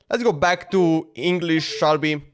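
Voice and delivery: ordinary talk, nothing marked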